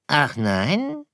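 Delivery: high, teasing fox voice; storybook fox, doubting and amused; sceptical fox. Acted. (zweifelnder Fuchs, hohe Stimme)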